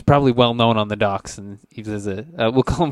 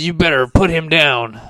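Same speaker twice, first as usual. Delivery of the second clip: raised, louder warning; threatening laborer; 6 semitones higher, 5 dB louder than usual. Acted. Dock laborer orders the adventurer to release the priest, loud warning.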